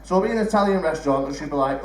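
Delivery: ordinary talk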